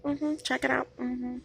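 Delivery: knowing mm-hmm. Real, not acted. Knowing self-satisfied affirmation.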